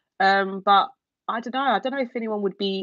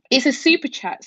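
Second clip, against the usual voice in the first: normal talking voice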